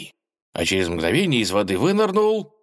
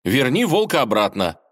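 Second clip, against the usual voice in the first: demanding; 5 semitones higher, 4 dB louder than usual. A narrator's reading. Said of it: "потребовал".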